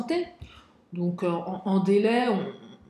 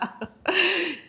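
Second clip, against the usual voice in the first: high laugh; 9 semitones higher than usual. Real, not acted. Laughs at own remark.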